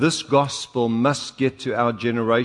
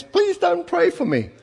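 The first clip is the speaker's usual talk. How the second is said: high pleading voice. Retold or imitated. Man pleading in retold story.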